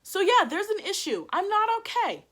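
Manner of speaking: high, loud exasperation; acted-out aggrieved outburst; retold indignant retort.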